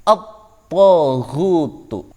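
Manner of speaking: at-taghutu slow, lam merged into a doubled t; slow demo of at-taghutu; assimilated al. Shown as a demonstration. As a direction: draw out at-taghutu, no l sound. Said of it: "Seperti contoh cara bacanya".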